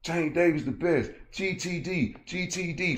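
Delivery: high-pitched mock troll chant; mocking high fanboy voice. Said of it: "He came in trolling".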